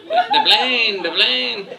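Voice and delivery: high excited shout of "The plane, the plane"; Fantasy Island sidekick yelling "The plane"; the plane call. Retold or imitated. Fantasy Island tattoo impression.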